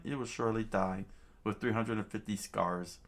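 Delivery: usual speaking voice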